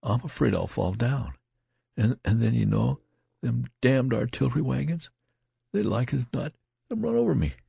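high-pitched, voiced stage whisper full of fear; shaking, fearful whisper. A narrator's reading. Terrified soldier confiding in his friend, shaking stage whisper. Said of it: "began to speak in a shaking whisper".

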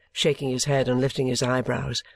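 usual speaking voice

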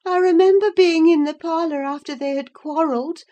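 fretful invalid recalling, pitched well up; doubting Catherine. A narrator's reading. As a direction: insist from memory in a high voice. Narrator voices Catherine recalling quarrel.

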